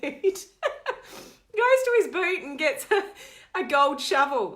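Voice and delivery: slow, high, laughing through the words; cracking up mid-sentence. Real, not acted. Laughing as she tells viewers about the prank.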